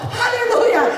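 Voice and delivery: mock hallelujah cry. Retells shouting hallelujah.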